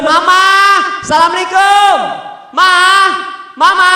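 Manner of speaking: impatient calling. Retold or imitated. Husband banging the door at night, calling his wife impatiently. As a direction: call out insistently through the door. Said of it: "digedor lagi".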